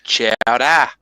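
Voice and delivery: put-on Chowder as chow-dah in a Boston accent. Friend teasing the Kennedy accent, mock Boston accent. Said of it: "with his East Coast accent".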